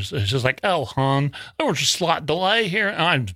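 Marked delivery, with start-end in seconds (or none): 0.6-2.9 hammy, higher Southern lady voice; Southern accent. Retold or imitated. Nice Washington lady explains a delay, mock Southern accent. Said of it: "Southern accent".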